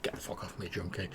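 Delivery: soft, breathless mock yell, barely voiced; retold shout, said under breath. Game master retelling the stranger's shove, hushed mock shout. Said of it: "like, shouted, like".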